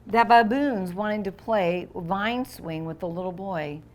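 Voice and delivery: plain reading voice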